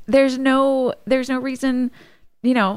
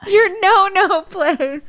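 mocking repeat; 9 semitones higher, slower than usual. Real, not acted. Mocking the childish term.

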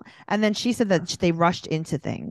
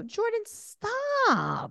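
exasperated; 14 semitones higher, 5 dB quieter, much slower than usual. Real, not acted. Exasperated plea to TV character.

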